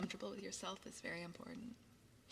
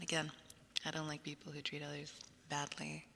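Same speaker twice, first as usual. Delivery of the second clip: normal talking voice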